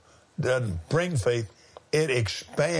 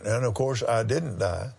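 ordinary delivery

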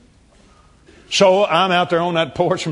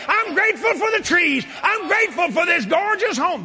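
high, exultant shouted praise; joyful hollering; 13 semitones higher than usual. Retold or imitated. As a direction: holler your thanks out across the yard. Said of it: "I hollered out loud and said".

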